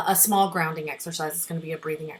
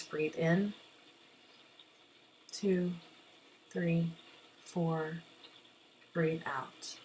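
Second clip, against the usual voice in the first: guided breathing count; 15 dB quieter than usual. Shown as a demonstration. Teacher leads students in breathe in, breathe out, hushed meditation count. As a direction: count them through the breath, fading to near-whisper.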